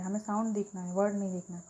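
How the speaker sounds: ordinary delivery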